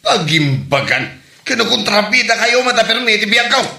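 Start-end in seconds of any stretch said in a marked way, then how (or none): 1.4-3.7 angry accusation. Acted. Son angrily accuses his parents of being villains. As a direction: throw the blame at them.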